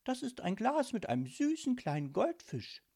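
high, sweet daughter's voice; cheerful little girl showing off her pet; smiling young girl. A narrator's reading. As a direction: answer with a smile, pitched high like a child. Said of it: "antwortete Mirabella lächelnd".

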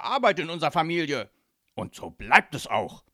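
loud, firm godfather laying down the law; brusque. A narrator's reading. Godfather defends the family trade to daughter, brusque. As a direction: shut the argument down forcefully. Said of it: "sagte er schroff".

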